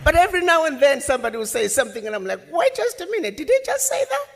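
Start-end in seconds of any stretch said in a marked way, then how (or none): 2.5-4.3 high-pitched double take, mock disbelief; squeaky what-did-I-just-hear; incredulous. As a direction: react in high, startled disbelief. Acted startled reaction in story.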